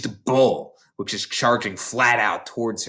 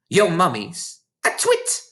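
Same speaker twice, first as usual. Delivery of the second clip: slow, scornful bellow; bellowed insult; 6 semitones higher than usual. A narrator's reading. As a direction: headmistress bellowing at a frightened girl. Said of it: "the Trunchbull bellowed".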